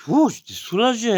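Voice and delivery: high, relieved ouh; relieved sigh. Retold or imitated. Finally peeing, high and relieved. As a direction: let the relief burst out.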